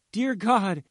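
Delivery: high cry of alarm; mother crying out in bewilderment; bewildered shout. A narrator's reading. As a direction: exclaim it high, aghast. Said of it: "the mother shouted, bewildered".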